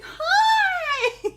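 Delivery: squealed high and loud Hi; mock cheerful lady. Lady greeting the dog in a squealing high voice. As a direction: gush like an overeager stranger. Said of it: "She's like".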